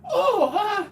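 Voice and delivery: high laughing squeal. Retold or imitated. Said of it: "She's younger than me".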